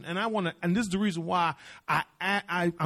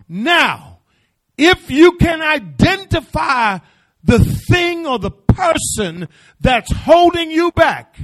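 preaching climax; 6 semitones higher, 12 dB louder than usual. Acted. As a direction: drive it home to the congregation.

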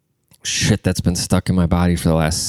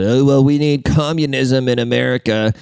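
higher, put-on nerd voice; mocking nerd; 4 semitones higher than usual. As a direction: mockingly imitate an earnest nerdy activist.

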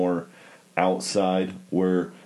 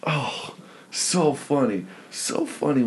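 breathless whisper; 5 semitones higher, slower than usual. Real, not acted. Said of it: "laughing whisper after story".

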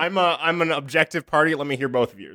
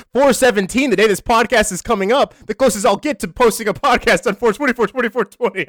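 high, loud, giddy chant; excited; 8 semitones higher, 6 dB louder than usual. Real, not acted. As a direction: hype it up like a stoner holiday. Excited about 420.

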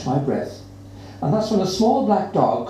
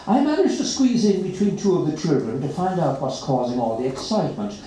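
ordinary delivery

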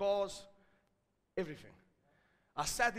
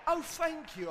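sudden sweet thanks; 7 semitones higher, slower than usual. Retold or imitated. Retold sudden sheepish thanks.

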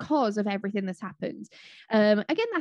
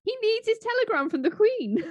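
playful; 10 semitones higher than usual. Pretend. Joking, repeating the correction.

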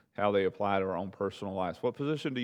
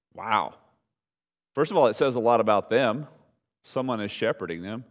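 ordinary talk, nothing marked

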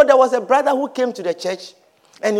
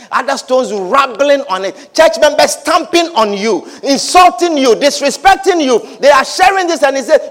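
ordinary delivery

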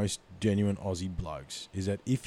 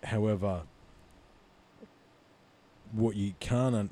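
ordinary delivery

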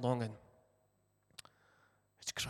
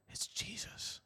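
softer, breathless whisper of recognition; awed whisper; 5 semitones higher, 9 dB quieter than usual. Acted. Mary realizing the gardener is Jesus, whispered in awe.

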